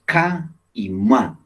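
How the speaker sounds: ca y ma spoken slow with a gap; syllables split slowly. Shown as a demonstration. Teacher splitting cama into syllables, slow. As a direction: spell out ca and ma slowly, beat by beat. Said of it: "en cama dos sonidos".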